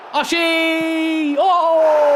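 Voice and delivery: high, loud, drawn-out whoop; excited shout. Real, not acted. Streamer reacting to Oshie in the match, excited shout. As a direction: roar the player's name in celebration. Celebrating player scoring in game.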